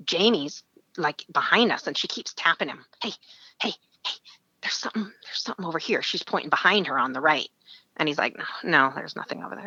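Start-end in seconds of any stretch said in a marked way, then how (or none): 3.0-6.0 hushed, fast, breathless whisper; urgent whisper. Retold or imitated. Friend whispering to him about something in the weeds, urgent hush. 8.4-9.7 hushed brush-off; dismissive whisper. Retold or imitated. Friend whispering back that there's nothing there, hushed dismissal. Whispered reply hiding in weeds.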